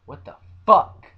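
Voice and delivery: sudden loud burst on one word; exasperated shout. Real, not acted. Outburst at the haters' dislikes.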